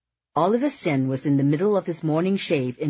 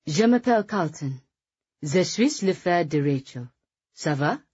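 polished French, as a fluent speaker; perfect French accent; 3 semitones higher than usual. A narrator's reading. Carlton introduces himself as Rachel's brother, French accent. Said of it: "impressed by his perfect French accent".

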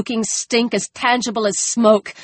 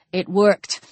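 usual speaking voice

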